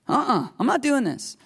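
high, emphatic playful refusal; mock refusal. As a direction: squeal out a stubborn no. Moses refusing God.

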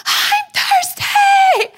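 very high, slow, loud crying scream; screaming child. Retold or imitated. Son screaming from his car seat, high crying yell. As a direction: wail it as a meltdown. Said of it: "he just starts screaming".

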